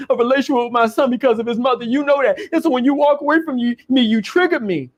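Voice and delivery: imitated crying boyfriend. Boyfriend guilt-tripping her after grabbing her, mock weepy whine. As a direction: play him tearfully guilt-tripping her. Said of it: "He starts crying".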